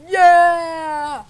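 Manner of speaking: loud, high victory yell; triumphant shout. Real, not acted. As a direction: roar in triumph. Big kill triumph.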